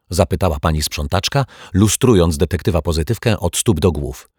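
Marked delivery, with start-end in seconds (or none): none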